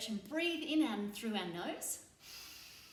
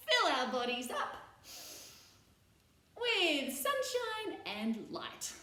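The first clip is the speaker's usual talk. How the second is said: drawn-out high cue; 5 semitones higher, slower than usual. Shown as a demonstration. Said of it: "sunshine stretch".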